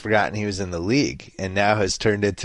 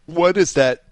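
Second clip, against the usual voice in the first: words jolted by a sudden hiccup; 7 semitones higher, 4 dB louder than usual. Real, not acted. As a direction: hiccup breaks into the phrase. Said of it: "excuse me, I just hiccuped".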